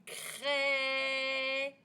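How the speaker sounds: CRAIE with a very long vowel; elongated vowel. Shown as a demonstration. Teacher asks pupils to hear the sound, "craie" stretched out. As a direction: hold the è of "craie" slowly. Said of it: "Quel est le son qu'on entend".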